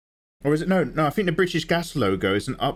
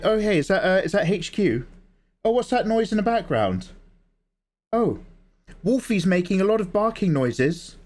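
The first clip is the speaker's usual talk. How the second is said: higher-pitched phone-call voice; gas company lady; 7 semitones higher than usual. Acted. Gas lady phoning HQ about the boiler, put-on lady's voice. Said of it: "And she was like".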